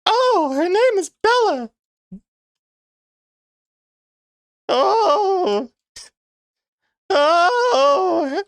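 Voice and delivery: very high slow falsetto lady, cracking into laughs; squeaky woman voice stretched out; slow shrill falsetto lady. Pretend. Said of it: "Let's hear your lady voice".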